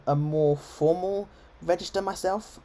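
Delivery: ordinary delivery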